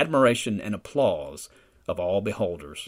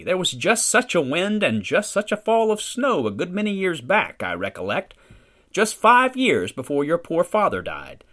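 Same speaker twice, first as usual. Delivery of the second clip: put-on old mother reminiscing; 3 semitones higher than usual. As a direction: lift into an elderly mother's wistful recollection.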